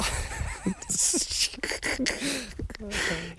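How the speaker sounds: long, very high wheeze of laughter; helpless laugh. Real, not acted. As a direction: crack up at your own bad luck. Laughing at his run of bad luck.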